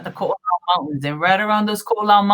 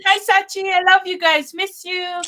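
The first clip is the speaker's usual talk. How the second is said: much higher, gushing hello; excited greeting; 11 semitones higher, slower than usual. Real, not acted. Greeting Ryan and Sachi watching live, much higher. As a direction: call out happily to friends. Calling out to viewers.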